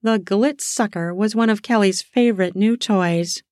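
usual speaking voice

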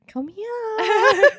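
calling a cat. Retold or imitated. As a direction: call the kitty squealing with joy. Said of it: "I was like".

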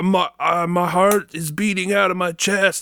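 high-pitched imitation of a frantic fan; mock excited fan. Host quoting fans' volleyball posts, mock frantic excitement. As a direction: raise the pitch like an overwrought fan posting.

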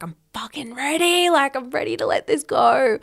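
rally yourself to let go; fired-up inner voice. Retold or imitated. To herself mid-breathwork, ready to release: high, fired-up.

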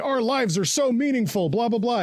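high, sarcastic mock-noble tone; mock grand speech. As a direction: parody a hollow pep talk. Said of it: "speech later on where he's like".

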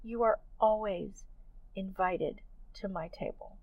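a quiet voiced reassurance falling to near silence; gentle whisper of God. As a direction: speak it as a tender hushed voice from above. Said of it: "heard God whisper to me".

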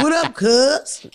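put-on slowed, laughing L A street accent; mock L A greeting. Teasing L A listeners, laughing slow L A accent. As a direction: drag it out slowly while laughing, L A style. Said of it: "And a lot of you".

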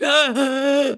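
sharp mimicked gasp. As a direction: suck in air, mock sob. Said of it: "I was literally just like".

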